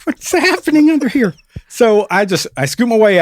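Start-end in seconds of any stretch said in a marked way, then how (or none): 0.0-1.3 high bewildered exclamation; confused panic under the cart; baffled. Retold or imitated. Repairman stuck under the cart, high and baffled. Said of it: "so I'm like".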